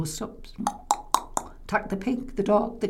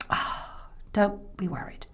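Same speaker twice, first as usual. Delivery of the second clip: whisper; 5 dB quieter than usual. Acted. Grandma soothing whisper.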